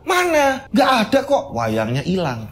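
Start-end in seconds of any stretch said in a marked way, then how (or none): 0.1-1.5 high-pitched puzzled denial; mother insisting in a high voice; ibu keheranan. Acted. Ibu heran tak melihat wayang. (ibu membantah dengan nada tinggi)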